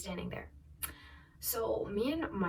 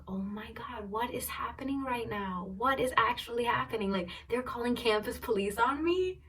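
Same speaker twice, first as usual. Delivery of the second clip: higher-pitched, hushed retold shock; stunned disbelief; 5 semitones higher than usual. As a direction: replay being utterly bewildered. Retelling own disbelief at police threat.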